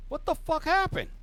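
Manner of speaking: a shocked crime boss; furious mobster seeing the wreck; angry mob boss. Acted. GM voices Sal.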